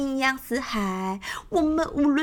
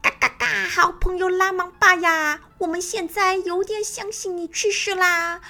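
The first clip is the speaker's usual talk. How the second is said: higher, fast, squawking bird voice; a sly hen cackling between words; 4 semitones higher, faster than usual. Acted. Lakanga teasing the fake-dead crocodile, squawking higher.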